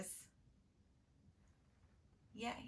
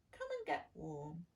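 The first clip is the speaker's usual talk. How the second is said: softer, lower gentle farmer; kind farmer; 5 semitones lower, 5 dB quieter than usual. Acted. Farmer welcoming the shivering duckling, gentle and low. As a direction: coax the cold bird in softly. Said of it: "he said".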